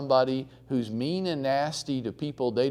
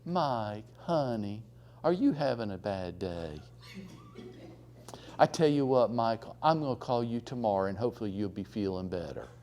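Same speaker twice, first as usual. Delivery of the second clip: soft sweet voice; 5 dB quieter than usual. Retold or imitated. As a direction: drop your voice and stay sugary calm. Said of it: "she just drops her voice".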